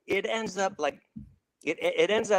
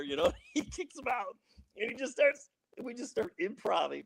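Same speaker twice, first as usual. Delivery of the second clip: ordinary talk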